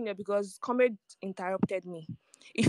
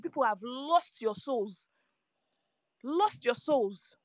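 slow, drawn-out words, each one weighed; slow emphatic lecture; much slower than usual. Real, not acted. Spicy lecturing the space, slowly and emphatically. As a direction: spell it out slowly so it sinks in.

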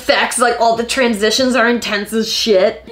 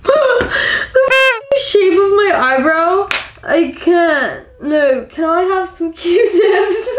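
high, slow, laughing and whining; tipsy giggling dismay; 9 semitones higher, slower than usual. Real, not acted. Drunk eyebrow mishap.

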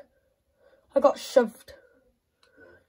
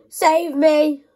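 louder, higher cry for help; desperate plea; 5 semitones higher, 11 dB louder than usual. Real, not acted. Gamer begging his player Cancelo to save him, loud. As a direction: beg loudly to be saved.

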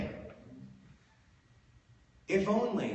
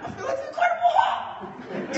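much higher and louder, squeaking on Hulk; squeaky quote; 5 dB louder than usual. Retold or imitated. Comic repeating friend's line with a squeaky rise. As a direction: squeak it up high like a whiny kid. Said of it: "It goes up at the end, like a sharp up".

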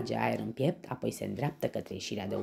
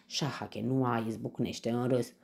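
usual speaking voice

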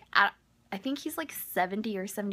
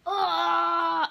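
high, loud cry of panic; strangled yelp; 10 semitones higher, 8 dB louder than usual. Retold or imitated. Caught with the note, a loud panicked squeal.

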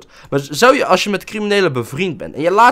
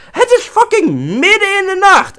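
much higher, louder incredulous outburst; mock outrage; 6 dB louder than usual. Gamer fumes that his girlfriend wants to visit at night, in a much higher mock outraged exclaim. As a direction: exclaim in disbelief at the hour.

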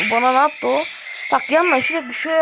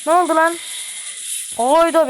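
jolted by a surprise hit; startled outcry; 5 semitones higher than usual. Real, not acted. Startled when attacked in game.

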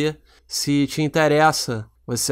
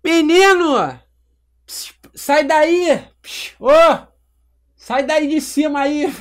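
much higher-pitched put-on dad character voice; Armandinho; 12 semitones higher, 4 dB louder, much slower than usual. Shown as a demonstration. Auditioning as a dad scolding his boy, high comic voice. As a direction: call out to the kid in a silly high voice. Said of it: "eu queria fazer o personagem".

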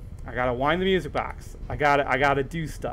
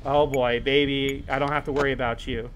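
ordinary talk

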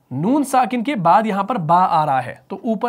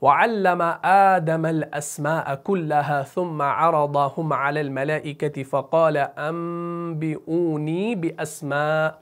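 Quran recitation. Shown as a demonstration. Teacher recites an example verse to learners, measured chant. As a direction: recite the verse, holding nasals and long vowels.

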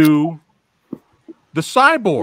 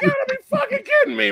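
very high, rushed mock outrage; incredulous; much faster than usual. Viewer protesting to the director, incredulous. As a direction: squeal it fast in retold disbelief. Retold outrage at the film's ending.

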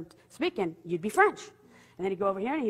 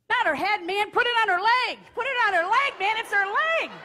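high, loud urgent shout; frantic yelling; 12 semitones higher, 6 dB louder than usual. Retold or imitated. Janie popping out from behind the boulder to correct him, frantic yelling. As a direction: yell the correction in comic alarm.

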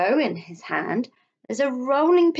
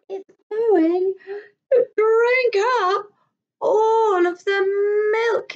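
put-on scared child; 12 semitones higher, 5 dB louder, slower than usual.

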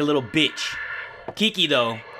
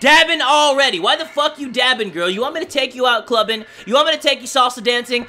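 mocking yell; 6 semitones higher, 4 dB louder than usual. Real, not acted. Mocking game character loudly.

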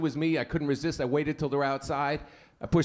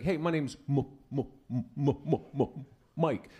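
stutter. Retold or imitated. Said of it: "messed up stutter".

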